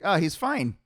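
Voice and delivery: amused retelling of mom's assurance; breezy reassurance. Retold or imitated. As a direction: imitate mom waving the worry away. Mom breezily reassuring coach, retold.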